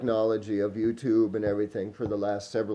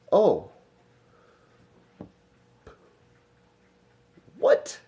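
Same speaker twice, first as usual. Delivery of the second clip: mock surprise; 8 semitones higher than usual. Mock astonishment at hidden history.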